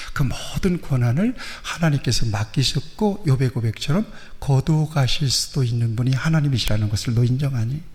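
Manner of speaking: normal talking voice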